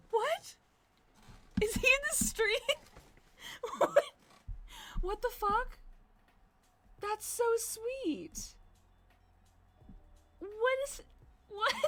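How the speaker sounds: high, excited disbelief with laughter; giddy high-pitched surprise; surprised squeals. Real, not acted. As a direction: squeal in happy shock. Surprised by surprise Venmo on stream.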